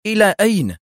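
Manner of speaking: urgent, high questioning call; هتاف متسائل. A narrator's reading. Anxious question calling after her. (سؤال بهتاف مرتفع)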